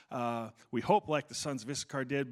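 usual speaking voice

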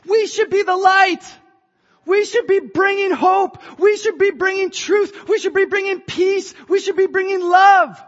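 rally the church with rising fire; fervent preaching. Acted.

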